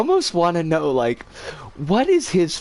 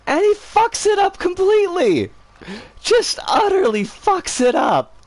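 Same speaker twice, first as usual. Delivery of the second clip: much higher, louder exasperated exclaim; excited outburst; 13 semitones higher, 3 dB louder than usual. Real, not acted. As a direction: let it burst out at how badly he blew it, up high. Mocking the customer's failure.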